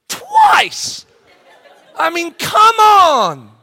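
very high, loud shout of disbelief; exasperated outburst. Real, not acted. Preacher venting frustration at himself, loud exasperated shout. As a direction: throw up your hands and shout it.

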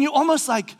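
ordinary talk, nothing marked

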